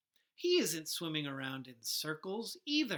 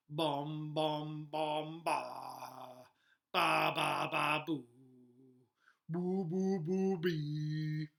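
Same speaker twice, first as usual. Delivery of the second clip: tuba noises; 3 semitones lower, much slower than usual. Acted. Boy plays his tuba.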